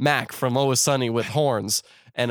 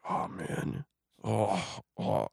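hushed, whispered groan; mock dismay; 2 semitones lower, 9 dB quieter, much slower than usual. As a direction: act defeated under the breath. Acts Jax's dismayed reaction.

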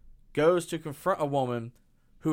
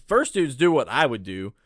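ordinary talk, nothing marked

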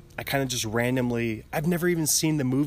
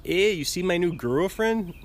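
put-on higher-pitched jokey blend of Canadian and Mexican accents; mock Canadian-Mexican accent; 6 semitones higher than usual. Comic Canadian showing off a new girlfriend, mock Mexican-tinged accent. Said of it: "combining the Canadian and Mexican accents".

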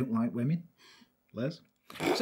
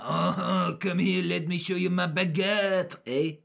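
put-on sleazy French-Canadian accent; leering French-Canadian flirt; 2 semitones higher, slower than usual. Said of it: "fucking love the accents".

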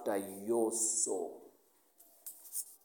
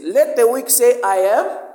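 loud, much higher declaration; proclaiming loudly; 10 semitones higher, 15 dB louder than usual. Acted. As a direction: shout the verse out like a battle cry. Preacher proclaiming the scripture.

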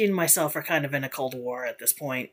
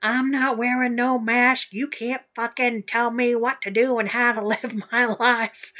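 higher, louder whiny mock voice; mocking anti-maskers; 7 semitones higher, 4 dB louder than usual. Mocking people online who refuse masks, higher and louder. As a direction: sneer it out like a brat. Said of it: "And basically, like".

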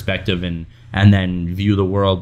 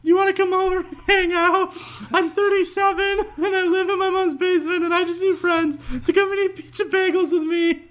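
put-on very high, childish pleading voice; mock lonely guy. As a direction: beg like a lonely man-child, squeaky.

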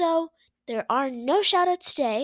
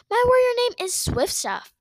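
high, louder protest; indignant high voice; 10 semitones higher, 5 dB louder than usual. Acted. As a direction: squeak it out indignantly. Swiftpaw insists on his name.

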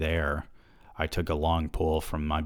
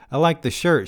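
higher female voice; 10 semitones higher, 5 dB louder than usual. A narrator's reading. Amy's line, high voiced.

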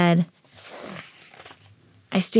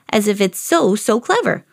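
usual speaking voice

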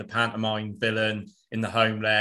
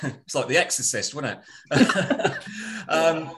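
laughing; 4 semitones higher, slower than usual. Real, not acted. Laughs at own echo.

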